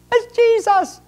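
high, squeaky mock shock; astonished cry. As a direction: gasp it out like a startled man. Astonished man in retold scene.

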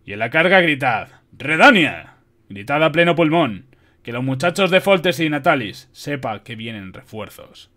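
loud rallying command, easing toward the end; forceful general's battle orders. A narrator's reading. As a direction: rouse the troops before the charge. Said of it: "ordenó tomando la espada".

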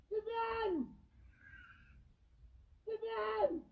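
calling out. Real, not acted. Calling for help.

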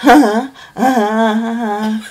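teasing laugh. Real, not acted.

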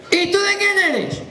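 put-on high, slow old villager's question; drawn-out prying old man; señor mayor. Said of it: "Y el señor mayor ahí". (señor mayor preguntón, agudo y lento)

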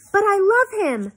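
high, loud, drawn-out plea; desperate lovestruck protest, voice way up; impassioned outburst. A narrator's reading. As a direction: cry it out slowly at the top of the argument. Daughter's climactic plea in quarrel.

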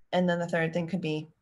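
ordinary talk, nothing marked

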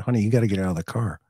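fast, hurried quoted instruction to his wife; rushed retold order. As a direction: rattle it off in a hurry. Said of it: "And I said".

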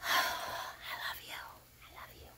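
soft, slow whispered farewell; tender whisper. Real, not acted. Saying goodbye to viewers, whispering tenderly.

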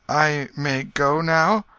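high, slow, hesitant asking; timid question. A narrator's reading. Tom asking the king if he may leave, timid voice. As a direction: ask slowly and timidly, pitched high. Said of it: "then turned timidly toward the king".

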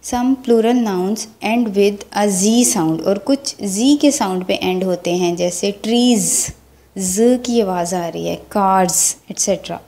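ordinary delivery

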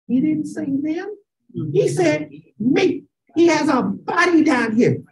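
ordinary delivery

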